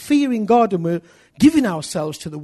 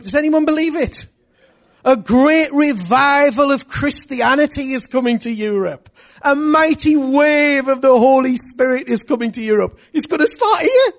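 high, loud prophetic proclamation; preaching at full force; 8 semitones higher, 5 dB louder than usual. Acted. Preacher to congregation, rising full-force proclamation. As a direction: proclaim the coming revival.

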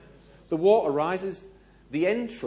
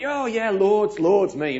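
high, loud, overconfident boast; retold cocky bluff, pitched way up; bluffing bravado; 8 semitones higher, 10 dB louder, slower than usual. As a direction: lie with big, breezy bravado. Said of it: "And lying, I went".

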